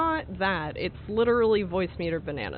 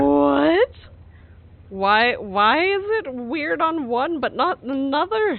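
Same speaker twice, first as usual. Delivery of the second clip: surprised; 5 semitones higher, 6 dB louder, slower than usual. Real, not acted. Baffled outburst at the glitch.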